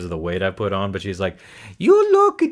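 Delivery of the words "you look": put-on high, loud Italian accent from an aunt; Italian accent, squeaky imitation. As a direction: mimic an Italian aunt's accent, pitched way up. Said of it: "she has an Italian accent".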